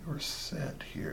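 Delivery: breathy aside to the listeners, barely voiced; whisper. Real, not acted. Host to listeners, teasing the set, whispered. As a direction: lean in and whisper it, slow.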